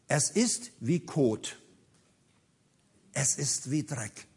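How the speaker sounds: low, slow and hushed verdict; soft, sober renunciation; quiet dismissal. Acted. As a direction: drop right down, weighing each word. Paul dismisses his achievements.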